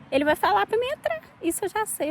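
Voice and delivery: high, loud retold objection; defiant protest. Mother refusing to ask the Lord, defiant protest. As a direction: push back, voice jumping up.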